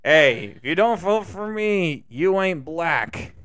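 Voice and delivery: slow, drawn-out put-on politician voice; mock president. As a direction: stretch it out as a politician scolding voters. Impersonates president's gaffe, slowed drawn-out.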